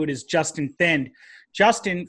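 normal talking voice

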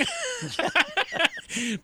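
high-pitched burst of laughter. Real, not acted. Host amused by his own road-funding quip, laughing.

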